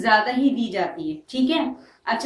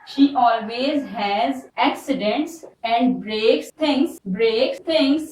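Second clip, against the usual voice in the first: slow dictation; much slower than usual. Shown as a demonstration. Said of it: "teacher dictating example sentence".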